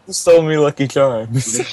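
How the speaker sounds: put-on a weak stab at an Irish accent; lightly put-on Irish accent; mock Irish accent. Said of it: "Not even Irish".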